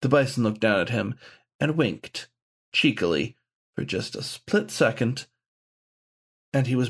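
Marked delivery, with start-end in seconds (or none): none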